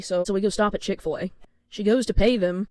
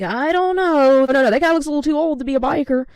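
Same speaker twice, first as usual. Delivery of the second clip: imitated loud aunt; 6 semitones higher, 8 dB louder than usual. Aunt sizing up bikers within earshot, loud tactless heckle. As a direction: call it out so strangers overhear.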